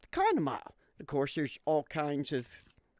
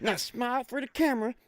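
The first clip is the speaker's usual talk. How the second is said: higher, eager croc-hunter voice; mock Steve Irwin; 7 semitones higher than usual. Steve Irwin holding an alligator, eager high voice. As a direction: play the thrilled croc hunter.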